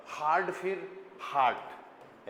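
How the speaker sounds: slow, drawn-out हार्ड फिर हार्ट; careful slow pronunciation. Shown as a demonstration. Teacher contrasting English hard with German hart, slowly. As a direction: spell out हार्ड then हार्ट very slowly, d against t. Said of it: "H A R टी".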